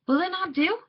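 high question with a sharp rise at the end; child asking father. A narrator's reading. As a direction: ask papa, pitch climbing. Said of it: "I asked".

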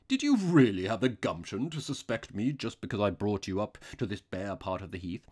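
indignant outlaw, loud at the start; increased intensity. A narrator's reading. Outlaw challenges the priest's suspicion, intensely. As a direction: burst out, then ease down. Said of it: "with increased intensity".

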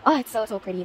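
normal talking voice

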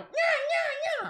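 very high squeaky bird cries; shrill mock parrot nya; parrot squawk imitation. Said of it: "my parrots are going".

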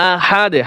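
আহাদে with a short bouncing release on d; qalqalah stop. Shown as a demonstration. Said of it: "কলকলা হবে". (শেষে দালে কলকলা করে আহাদে)

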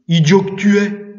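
narrated fury, voice lifted; furious sacristan. A narrator's reading. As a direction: lash out at the boy, loud and high. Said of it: "continua le sacristain furieux".

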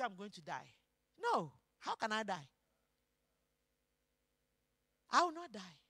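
low, very soft voice; hushed disbelief. Acted. Preacher voicing the king told he will die, hushed. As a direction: say it low, as if to oneself.

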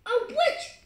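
high loud startled scream. Real, not acted. Kid spots a witch again, high loud scream. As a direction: shriek at another witch. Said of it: "don't scream".

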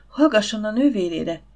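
ordinary delivery

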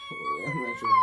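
very high, loud cooing cry of love. Real, not acted. Mother gushing at her little son, high and loud.

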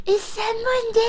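very high, mock helpless cry; hammed-up, high-pitched calling. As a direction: imitate a foolish horror victim calling into the dark. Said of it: "go into a dark place and go".

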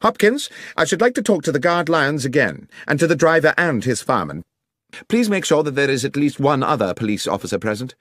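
brisk detective. Acted. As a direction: give orders as a commanding detective. Voices the detective giving orders.

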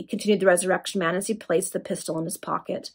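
ordinary delivery, nothing marked

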